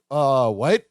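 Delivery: low, dopey-puzzled imitation; confused Balky. Balky not getting Lydia's remark, low confused mumble. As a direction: drop the pitch, baffled. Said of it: "Balky's face is like".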